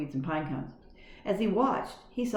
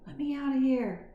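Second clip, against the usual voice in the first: squeaky mouse; 8 semitones higher than usual. A narrator's reading. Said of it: "quite sure that the woman could not understand".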